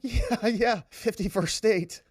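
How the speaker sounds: lightly voiced Trudeau, mocking, drawn out and slow; a hint of a dismissive Trudeau brushing it off; slow, mocking take on Trudeau shrugging off fifty-first state. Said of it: "He's like".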